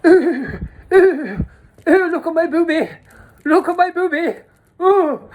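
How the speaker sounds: high, loud mock cooing; camp ooh. High man showing off his chest, mock cooing. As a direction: coo it like a giddy camp man. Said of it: "he was like".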